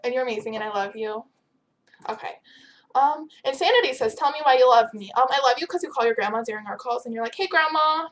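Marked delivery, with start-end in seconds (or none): none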